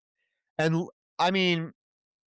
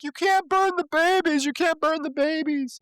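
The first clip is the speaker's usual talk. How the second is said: panicked plea, much higher pitched; freaking out. Retold or imitated. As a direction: beg them in a frantic high voice. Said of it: "like, freaking out".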